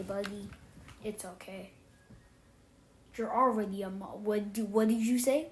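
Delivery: ordinary talk, nothing marked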